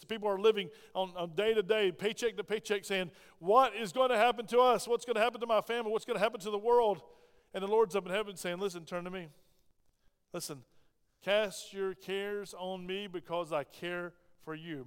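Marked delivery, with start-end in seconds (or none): 3.4-7.0 mock panicked voice. Anxious people voiced in sermon. 8.6-14.9 gentle reassuring Lord. Retold or imitated. The Lord voiced in sermon.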